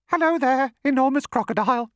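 very high, trilling bird voice; singsong bird. A narrator's reading. Roly-poly bird greets the crocodile, high singsong. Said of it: "sang the roly-poly bird".

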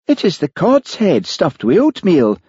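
high-pitched, desperate explaining. A narrator's reading. Charlie explaining his dish to appalled Olivia, desperate. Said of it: "said Charlie desperately".

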